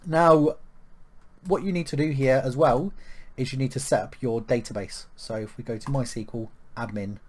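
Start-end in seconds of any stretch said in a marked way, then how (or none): none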